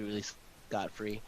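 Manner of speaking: ordinary delivery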